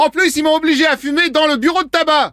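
high mock tattling; accusing patient. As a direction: chime in with a high-pitched complaint. Prankster plays the accusing patient.

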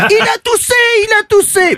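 high panicked crowd cry; crowd shouting. Retold or imitated. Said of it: "ça crie, ça se bouscule". (cri de foule paniquée)

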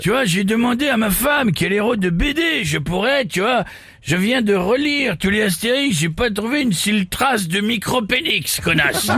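Jean-Marie impression. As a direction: bark the joke like a gruff comic. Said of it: "Ça va, Jean-Marie?".

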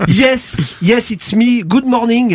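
mock London English accent in French. English lord caller greets French radio hosts, hammed-up English accent. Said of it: "Oh là là, quel accent".

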